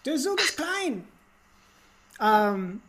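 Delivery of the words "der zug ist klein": playful German impression, climbing in pitch; exaggerated German accent; mock German. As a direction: put on a German voice, rising higher. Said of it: "he said it in, like, German".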